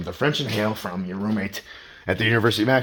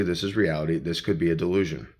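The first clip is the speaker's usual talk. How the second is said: ordinary talk, nothing marked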